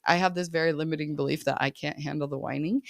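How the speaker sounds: ordinary talk, nothing marked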